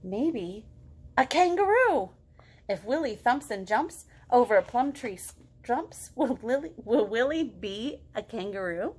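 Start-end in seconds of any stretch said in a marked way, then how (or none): none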